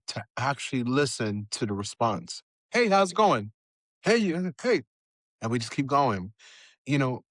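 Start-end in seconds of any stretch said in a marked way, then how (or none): 2.7-4.8 breezy greeting. Retold or imitated. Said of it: "we'll say things like".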